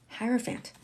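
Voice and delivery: Hierophant repeated softly with a "fant" ending; preferred hierophant. Shown as a demonstration. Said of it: "But it's hierophant".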